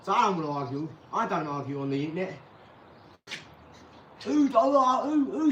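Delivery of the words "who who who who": incredulous who. Real, not acted. Mocking internet arguers.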